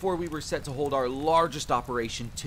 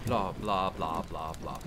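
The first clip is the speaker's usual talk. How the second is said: dropping lower, trailing off in rote syllables; dismissive skimming; 8 semitones lower, slower than usual. Acted. Soldier skipping the rest of the creed, dismissive low trail-off. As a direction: let it sink away, not bothering.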